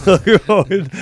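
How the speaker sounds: laughing. Real, not acted. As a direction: crack up mid-read. Laughs while reading comment.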